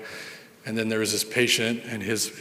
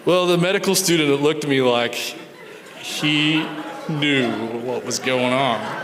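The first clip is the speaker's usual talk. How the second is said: laughing; 3 semitones higher, slower than usual. Real, not acted.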